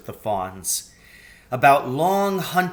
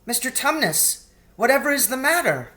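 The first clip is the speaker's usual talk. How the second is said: put-on concerned girl Lucy; 12 semitones higher, faster than usual. A narrator's reading.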